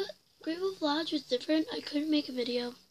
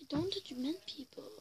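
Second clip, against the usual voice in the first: whispering; 9 dB quieter than usual. Real, not acted. Whispered aside to viewers.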